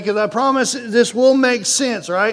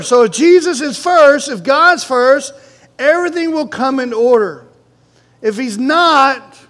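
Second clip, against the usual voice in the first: louder, higher preaching with the congregation answering back; fiery preaching; 5 semitones higher, 5 dB louder than usual. Acted. Pastor driving his point to the congregation, fiery preaching. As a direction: press the point home to the pews.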